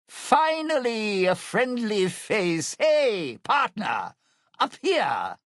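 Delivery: loud, high, drawn-out hail; calling out. Acted. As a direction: call up a partner's attention with relief. Calls to partner from above.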